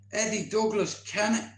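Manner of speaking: normal talking voice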